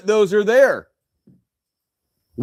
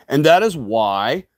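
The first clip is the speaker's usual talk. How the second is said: ordinary talk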